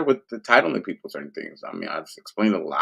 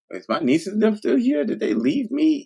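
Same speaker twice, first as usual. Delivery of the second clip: much higher, mock worried questions; high, panicky; 14 semitones higher than usual. Wondering if the nieces were left, panicky. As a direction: squeak out the worry.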